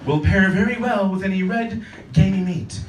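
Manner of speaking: swirl the glass and pronounce judgment; snooty wine sommelier. Retold or imitated. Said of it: "sommelier can grab a glass".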